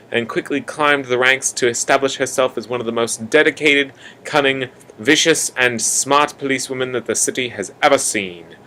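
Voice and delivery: usual speaking voice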